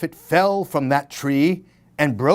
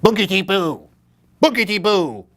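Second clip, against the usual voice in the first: spooky skeleton; 4 semitones higher, faster than usual. A narrator's reading. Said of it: "clacked the skeleton".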